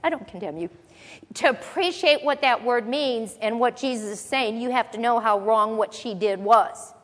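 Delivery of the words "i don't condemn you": soft, lower, breezy dismissal; mock casual shrug-off. Teacher mimics a too-easy Jesus, casual mock reassurance. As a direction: brush the sin off lightly, like it's nothing. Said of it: "Jesus isn't just saying".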